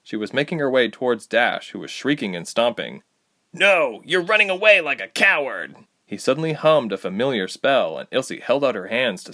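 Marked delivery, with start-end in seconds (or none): 3.5-5.8 angry accusation. Acted. Tyke accusing angrily.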